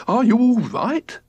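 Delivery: high, slow anxious call; worried cry. A narrator's reading. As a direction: check on your wife in alarm. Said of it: "cried George".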